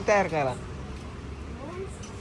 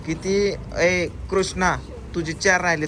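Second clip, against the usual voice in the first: ordinary talk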